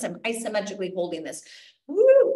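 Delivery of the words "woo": excited woo. Real, not acted. After jump demo.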